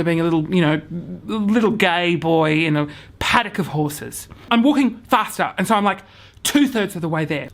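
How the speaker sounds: usual speaking voice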